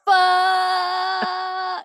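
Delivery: put-on high drawn-out kid's yell of fuck; mock child yelling down a slide. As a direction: stretch out one long high cry like a small child. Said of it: "the entire time he goes".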